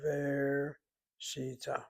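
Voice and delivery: versita very slow, VER held, then si-ta after a gap; stressed third syllable. Shown as a demonstration. Teacher showing where the accent falls, versita slowly split after VER. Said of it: "and then the third syllable".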